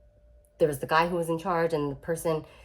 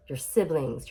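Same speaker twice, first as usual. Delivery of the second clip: ordinary talk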